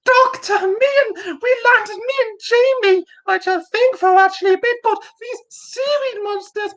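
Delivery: very high-pitched, hammed-up crying damsel; Victoria. Podcaster recapping seaweed monsters as Victoria, tearful falsetto parody. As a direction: wail it in a mock falsetto. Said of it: "you're gonna have to sound like".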